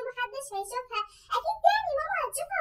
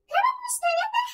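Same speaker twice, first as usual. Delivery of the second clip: mock wail; 11 semitones higher, 6 dB louder than usual. Mock-whining disbelief.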